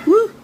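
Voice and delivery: loud burning whoop; woo from the heat. Real, not acted. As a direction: whoop against the spice. Reacting to habanero heat.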